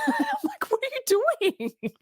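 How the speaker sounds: high, laughing what are you doing; laughing disbelief. Retold or imitated. Podcaster recounting watching the movie, laughing in disbelief. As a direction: laugh through the question, baffled.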